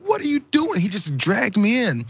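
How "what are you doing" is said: high, startled protest; 高声惊问; mock alarm. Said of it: "And I'm like".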